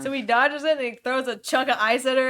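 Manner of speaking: ordinary delivery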